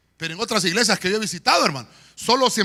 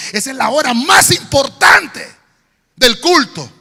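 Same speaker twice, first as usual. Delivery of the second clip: loud sung-out preaching; full-force preaching; 3 semitones higher, 12 dB louder than usual. Acted. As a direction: preach it with music. (predicación a todo pulmón)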